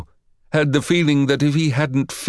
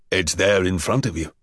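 put-on boy's voice dropping lower; deeper voice; 5 semitones lower than usual. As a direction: drop the voice down. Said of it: "his voice dropped an octave or two".

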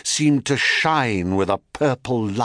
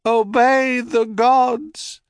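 high, slow echoed words; helpless echo; 13 semitones higher, 3 dB louder, slower than usual. A narrator's reading. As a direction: repeat it like an echo. Echo repeats Hera's words.

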